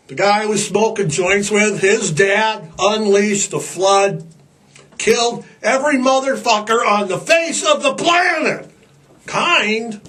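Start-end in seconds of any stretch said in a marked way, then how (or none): none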